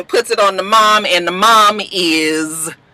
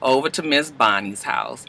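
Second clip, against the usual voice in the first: ordinary talk, nothing marked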